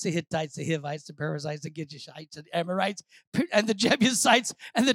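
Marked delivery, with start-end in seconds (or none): none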